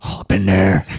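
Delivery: the same words belted out loud; loud, punchy repeat. Pretend. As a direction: blurt it out loud after the whisper. Dogs sniffing each other.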